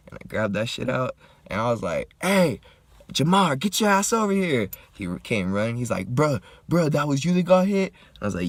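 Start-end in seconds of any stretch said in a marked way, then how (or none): 2.2-4.7 loud, high-pitched holler to a friend; calling out. Retold or imitated. Hurt man calls his homie over, loud urgent holler. 6.1-7.9 loud, high-pitched disbelief; shocked friend. Retold or imitated. Homie runs up to the hit man, loud shocked question.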